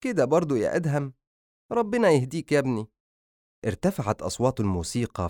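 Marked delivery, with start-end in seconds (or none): 0.0-2.9 higher-pitched sorrowful mother; mother's worried, saddened plea; sad, perplexed. A narrator's reading. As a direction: gently reproach a son in a raised voice. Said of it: "ووقفت عائشة حائرة وحزينة وقالت".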